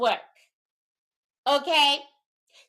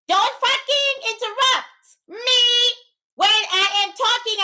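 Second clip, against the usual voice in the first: furious warning; 6 semitones higher than usual. Real, not acted. Snapping at a chatter.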